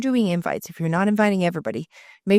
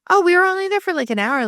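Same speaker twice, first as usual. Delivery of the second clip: high, loud opening that settles down; imitated protesting friend; 12 semitones higher, 6 dB louder, faster than usual. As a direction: lightly mimic a friend's indignant protest. Voices friend protesting her early exit.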